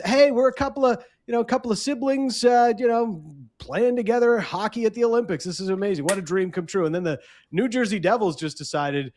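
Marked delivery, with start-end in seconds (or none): 0.0-6.9 high, gushing voice of proud brothers; mock cheerful siblings. Host voicing the brothers posing for the photo, high and gushing. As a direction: ham up the happy Olympic brothers.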